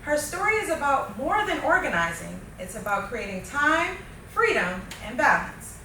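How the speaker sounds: upbeat, polished show opener; podcast host intro. Acted. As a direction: read it like a show host welcoming listeners.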